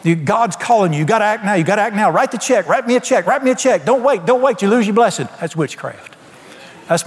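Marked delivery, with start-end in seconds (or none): 0.0-5.3 higher, pushy preacher yell; mock TV preacher screaming. Preacher mocks a TV charlatan, higher and pushy. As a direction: ham up a greedy televangelist demanding money. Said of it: "the guy was screaming in the television".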